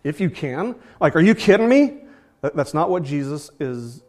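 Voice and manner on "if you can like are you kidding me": high, loud scoffing retort; a mock are-you-kidding-me scoff; mock rebuke. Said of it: "it might seem as though Jesus is rebuking him".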